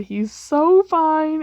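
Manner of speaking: high, loud and slow, swooning over the crush; giddy squeal. Acted. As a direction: squeal it out as if you can't contain yourself. You're just, like, internally screaming.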